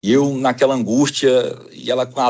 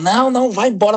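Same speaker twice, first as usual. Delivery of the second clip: much higher, urgent "go now"; urgent plea; 11 semitones higher, 4 dB louder than usual. Retold or imitated. Sister-in-law urging him to drive away, much higher and urgent. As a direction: push him to drive off at once.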